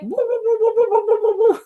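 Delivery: high mock whining woos; dog whimper imitation. Owner imitating dog's wagging excitement, high playful woo. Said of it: "He's just like".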